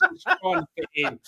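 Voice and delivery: mocking eager voice.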